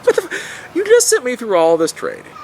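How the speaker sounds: high-pitched baffled what-the-hell; incredulous outburst. Retold or imitated. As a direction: react like you can't believe the paperwork.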